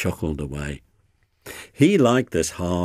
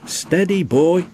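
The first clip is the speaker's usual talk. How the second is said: high, raised cautioning call; urgent warning; 11 semitones higher, 5 dB louder than usual. A narrator's reading. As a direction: try to check a reckless engine. Driver warns the speeding engine.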